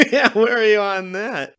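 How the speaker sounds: giggling back at a tease; laughing. Real, not acted. Laughs at being called Doctor Lady.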